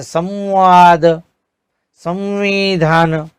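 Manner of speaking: संवाद slow, bindu sounded as म; slow pronunciation demo. Shown as a demonstration. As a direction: stretch संवाद so the म is heard. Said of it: "उसका उच्चारण म की तरह होता है". (संवाद में अनुस्वार को म की तरह, धीरे)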